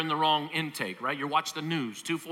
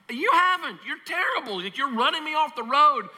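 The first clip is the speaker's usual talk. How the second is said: much higher, scolding the donkey in a mock huff; mock angry rider; 10 semitones higher than usual. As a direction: snap back at the donkey, hammed-up. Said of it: "And Balaam's like".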